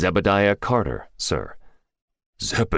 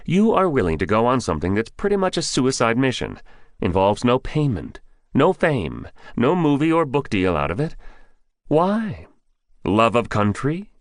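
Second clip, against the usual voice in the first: a lightly voiced general, higher, pushing hard; pressing older general; 7 semitones higher than usual. General questions the candidate's motives, lightly voiced pressing. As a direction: challenge him, rising with each point.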